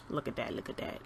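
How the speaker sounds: breathy whisper to herself; hushed, admiring whisper. Real, not acted. Admiring the finished blush.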